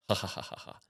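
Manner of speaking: soft, breath-only mock cackle; imitated mocking laugh. Voices mock him by the fridge, whispered laugh. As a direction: laugh under your breath, taunting.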